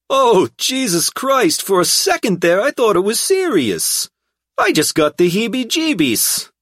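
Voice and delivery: high, loud brash thug; relieved brash hoodlum voice. A narrator's reading.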